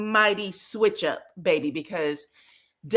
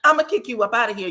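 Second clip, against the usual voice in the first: fast mock threat; 3 dB louder, much faster than usual. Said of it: "And she said".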